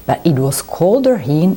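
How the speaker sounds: ordinary delivery, nothing marked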